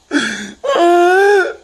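long, high, loud wail; real wailing sob. Real, not acted. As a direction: let out an anguished cry. Breaks down after daughter's name.

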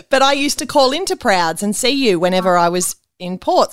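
ordinary talk

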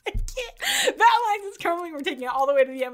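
high laughing, then a fast rush at the end. Real, not acted. [name] laughs at her own recap line. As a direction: giggle through it and hurry on.

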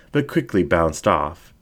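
plain reading voice

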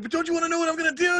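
begging your wife to hear the idea in the middle of the night; frantic eagerness. Retold or imitated. Retelling insisting to wife at 2am.

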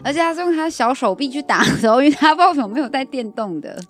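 excited. Real, not acted. Laughing retelling of friend.

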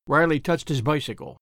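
ordinary delivery

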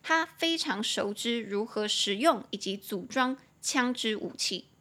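normal talking voice

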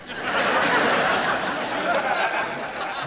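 high breathy wheezing laugh, no voice. Real, not acted. Comic laughs at his own joke, high airy wheeze.

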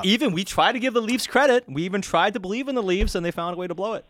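high mocking fan voice; mock gloating fan. Mocking Canucks fans' future gloating.

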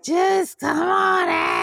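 high, slow, squealing elf; excited elf voice. Acted. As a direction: gush like an overexcited elf. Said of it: "you know me, Santa".

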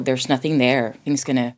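normal talking voice

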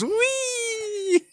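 high, drawn-out "whee"; mock squeal of riding along; playful whee. Teasing friend about riding the river of random choices, playful high whee.

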